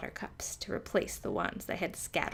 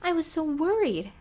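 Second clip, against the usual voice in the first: high, louder, out-of-breath worry; worried cry; 10 semitones higher, 6 dB louder than usual. A narrator's reading. Frog friend arriving breathless and worried, crying out. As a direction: gasp it out after running. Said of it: "Hattie cried, huffing and puffing".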